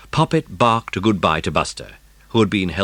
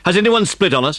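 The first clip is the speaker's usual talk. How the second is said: angry; 12 semitones higher, 4 dB louder, faster than usual. A narrator's reading. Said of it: "asked Bill Lorenzo angrily".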